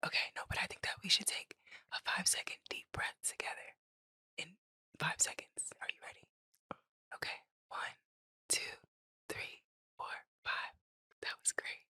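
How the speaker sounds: very soft, whispered breathing guide; ASMR whisper. Pretend. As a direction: whisper a gentle countdown for a shared breath. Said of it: "Are we doing an A S M R breath".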